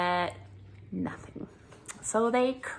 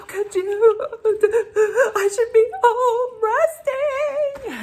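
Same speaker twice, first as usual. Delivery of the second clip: high, slow wailing through laughing tears; hammed-up sob at the pharmacy counter; mock sobbing; 7 dB louder, slower than usual. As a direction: wail it out, half crying half laughing. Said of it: "I was just sobbing".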